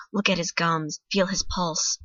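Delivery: ordinary delivery, nothing marked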